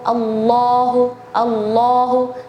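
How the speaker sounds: Allahu slow with an open a; correct Allahu. Shown as a demonstration. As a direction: Allahu drawn out, mouth open. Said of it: "kalau misalnya Allahu sama Allahu".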